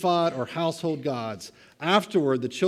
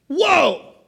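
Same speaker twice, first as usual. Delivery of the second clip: high, loud whoa of wonder; excited whoa; 13 semitones higher, 7 dB louder than usual. Real, not acted. Preacher marveling at the covenant blessings, excited. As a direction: burst out in amazement.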